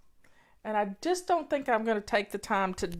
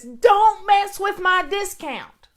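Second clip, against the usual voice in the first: loud, high imitation of a screaming woman; mock-furious high yell, drawn out; screamed retort; 9 semitones higher, 10 dB louder, slower than usual. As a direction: scream it slowly, each word hammered, as she did. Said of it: "she screams at him".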